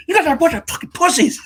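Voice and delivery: high, loud mock-furious yell; Ben Affleck pissed off. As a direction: imitate a coach blowing up at his players. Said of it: "Ben Affleck gets fucking pissed".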